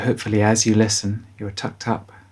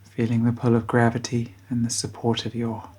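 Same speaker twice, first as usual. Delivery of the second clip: guided bedtime meditation. Acted. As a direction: guide children gently towards sleep, unhurried.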